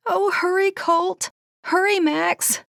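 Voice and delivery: high, desperate call across a field. A narrator's reading. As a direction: shout them on to hurry. Calling riders from afar.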